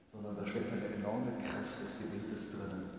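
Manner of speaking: ordinary delivery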